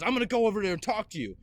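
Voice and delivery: retold angry threat; threatening stepdad. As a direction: imitate a stepfather rushing out a threat.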